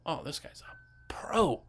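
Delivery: slow, impressed whisper; soft, drawn-out customer's aside; hushed admiration. Acted. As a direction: whisper it in mock awe.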